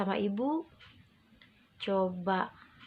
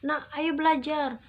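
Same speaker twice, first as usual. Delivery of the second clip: higher, louder nagging parent; 5 semitones higher, 3 dB louder than usual. Retold or imitated. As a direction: push the child to study.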